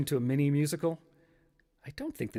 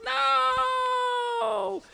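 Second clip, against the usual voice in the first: extremely high, long-held theatrical wail; anguished cry; 3 dB louder than usual. Acted. Steve refuses the tempting phantoms, long high stage scream. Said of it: "And Steve goes".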